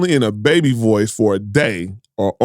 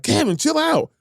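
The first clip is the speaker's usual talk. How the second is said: high mock pleading; 13 semitones higher than usual. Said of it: "They was like".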